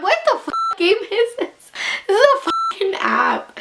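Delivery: high-pitched shocked outburst; outraged disbelief. Real, not acted. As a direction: squeal it in disbelief. Outraged at app's question.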